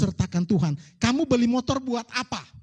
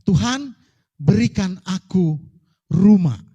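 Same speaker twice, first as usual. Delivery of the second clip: loud emphatic prayer; 3 semitones lower, 10 dB louder, slower than usual. Shown as a demonstration. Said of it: "preaching emphasis, loud prayer".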